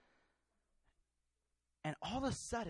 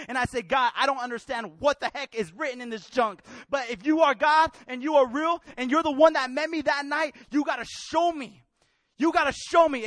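raised, driving testimony with fervent prayer; impassioned preaching; 8 semitones higher, 11 dB louder than usual. Real, not acted. Preacher recounting his cries to God, impassioned preaching. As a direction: preach it urgently, pleading with God.

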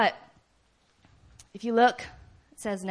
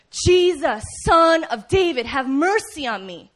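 loud, raised plea easing at the end; crying out; 4 semitones higher, 7 dB louder than usual. Acted. Beggar crying out all the more to Jesus, loud plea. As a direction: call out urgently for mercy. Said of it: "Crying out, crying out".

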